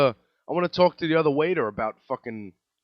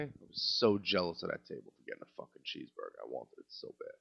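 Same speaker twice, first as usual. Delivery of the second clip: much lower, much softer mournful murmur; mock-mournful murmur; 10 semitones lower, 9 dB quieter than usual. Real, not acted. Waiter craving a cheeseburger, sinking mournful murmur. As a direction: sink into mock longing.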